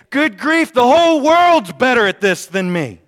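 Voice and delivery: hammed-up loud exasperation; exasperated outburst. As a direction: throw up your hands at the whole world. Exasperated quote about Facebook.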